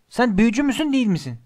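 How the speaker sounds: high outburst, easing at the end; yelling. A narrator's reading. Ron reminding Hermione she has magic, yelling. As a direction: demand it in exasperation. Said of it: "diye haykırdı Ron".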